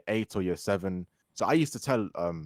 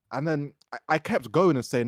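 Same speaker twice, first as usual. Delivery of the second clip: ordinary talk, nothing marked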